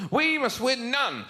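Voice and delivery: hammed-up officious pronouncement; mock stern decree. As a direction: spell out the absurd demand. Mocking Australian officials' attitude.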